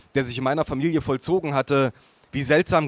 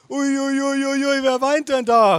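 put-on very high baby-talk squeal, swelling louder; cooing relatives calling out; 12 semitones higher, 4 dB louder than usual. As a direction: coo at a crying baby on one high held note. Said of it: "riefen sie alle gleichzeitig".

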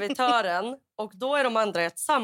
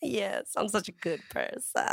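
mock saintly; 6 dB quieter than usual. Acted modest reply after face-saving.

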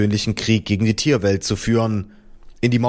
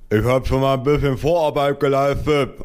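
higher, muffled mushy speech; half-paralysed father; 4 semitones higher than usual. A narrator's reading. Father explaining to environment officer, numb-mouthed slur. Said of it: "dessen komplettes Gesicht halbseitig gelähmt war".

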